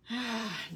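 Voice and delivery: soft frustrated sigh. Real, not acted. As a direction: let out a quiet sigh mid-search. Frustrated search for letters and colors.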